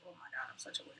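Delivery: whispered self-mockery; embarrassed whisper. Real, not acted. Host whispering to herself after sounding weird. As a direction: breathe it out, laughing at yourself.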